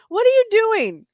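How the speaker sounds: high, loud demand; exasperated scolding. Real, not acted. Owner catching cat at it, high exasperated scolding.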